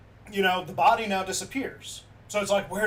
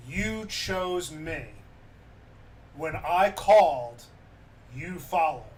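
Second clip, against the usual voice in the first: solemn forgiveness; slower than usual. Retold or imitated. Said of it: "he responds with these words".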